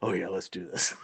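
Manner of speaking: very low, hushed mock eagerness; sly undertone, playing along; conspiratorial agreement. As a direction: murmur it like a co-plotter. Pretend partner agreeing to the plot.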